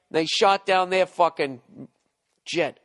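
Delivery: ordinary delivery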